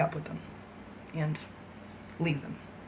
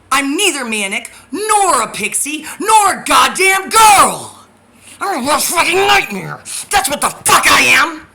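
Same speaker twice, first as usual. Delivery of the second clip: furious shout; 7 semitones higher, 11 dB louder than usual. Real, not acted. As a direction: scream it back at the people who mock you. Furious rant at critics.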